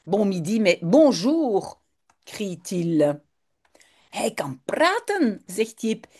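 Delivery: ordinary talk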